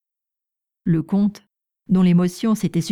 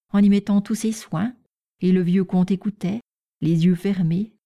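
ordinary delivery, nothing marked

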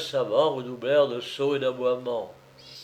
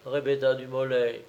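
ordinary delivery, nothing marked

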